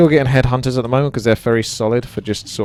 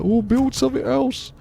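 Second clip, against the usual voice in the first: high squeaky mock of a complaining viewer. Viewers mock-complaining about his build, high and whiny. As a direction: whine it like a fussy teammate. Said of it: "they'll probably be like".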